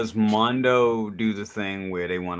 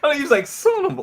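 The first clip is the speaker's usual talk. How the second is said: cracking up in a high mock cry; laughing high mock outrage; 3 dB louder, faster than usual. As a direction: squeal it through a laugh, way up high.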